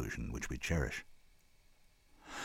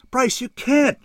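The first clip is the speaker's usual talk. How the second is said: very high, loud protest; distressed cry; 9 dB louder, slower than usual. A narrator's reading. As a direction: cry out in shocked disbelief. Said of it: "Shara cried".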